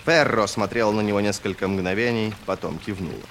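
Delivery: plain reading voice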